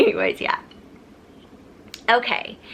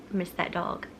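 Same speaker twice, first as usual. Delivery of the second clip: tearful murmur; 9 dB quieter than usual. Real, not acted. Grieving end of dog story.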